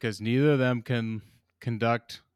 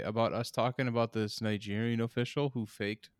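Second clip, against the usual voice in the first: ordinary talk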